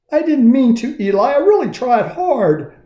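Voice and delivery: high, earnest apology; lightly voiced anxious puppet; pleading Punchinella. A narrator's reading. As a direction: beg to be believed.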